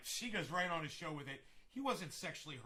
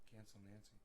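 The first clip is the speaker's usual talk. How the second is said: much softer tasting the words Cancel Nancy; softly trying the rhyme; 6 semitones lower, 15 dB quieter, faster than usual. Shown as a demonstration. Vlogger testing a rhyme to himself, hushed. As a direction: mutter it to yourself. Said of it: "kinda rhymes".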